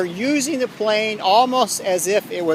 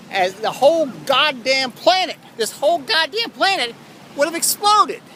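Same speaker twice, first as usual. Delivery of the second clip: rising, more and more worked up; building rant; 6 semitones higher than usual. Real, not acted. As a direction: climb higher with each phrase. Ranting about the planet exploding.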